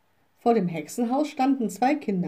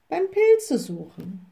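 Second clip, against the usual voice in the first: slow, higher echo with a touch of pondering; repeating back; 7 semitones higher, 4 dB louder, slower than usual. A narrator's reading. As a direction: repeat it lightly as the little witch. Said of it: "wiederholte die kleine Hexe". (langsam und höher wiederholend)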